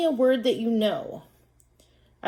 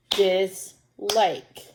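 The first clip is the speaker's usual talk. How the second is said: "Dislike" in two separate beats, DIS, LIKE; clapped-out syllables. Shown as a demonstration. Teacher clapping out a word with pupils, split "Dislike". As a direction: break "Dislike" evenly between its syllables. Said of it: "Let's clap that one out".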